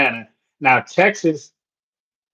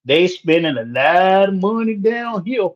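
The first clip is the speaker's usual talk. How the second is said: amateur put-on Texas twang; Texas accent; 4 semitones higher than usual. Newcomer confiding about money spent down here, mock Texas accent. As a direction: play a local with a shaky grasp of the Texas accent. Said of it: "I know my Texas accent ain't quite there yet".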